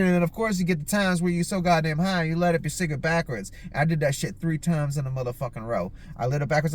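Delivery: normal talking voice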